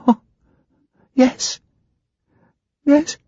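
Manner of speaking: put-on a scared girl barely answering; frightened young woman. Ginger to the hypnotist, high and frightened.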